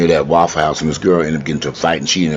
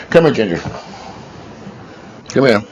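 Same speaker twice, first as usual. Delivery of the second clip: calling the dog; 7 semitones higher than usual. Real, not acted.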